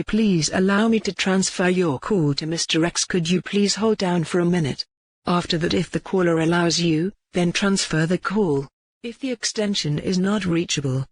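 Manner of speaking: ordinary talk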